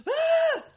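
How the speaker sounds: loud, high mock shriek; startled gasp. Friend startled on her porch by a greeting, loud high shriek. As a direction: imitate a jumpy friend's sharp scream. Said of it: "And she's like".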